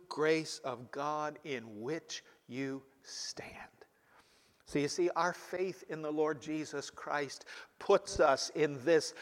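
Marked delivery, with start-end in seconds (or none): 0.1-3.6 slow hushed whisper to close the verse. Real, not acted. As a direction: let the verse fade into a reverent whisper. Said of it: "whispered end of verse".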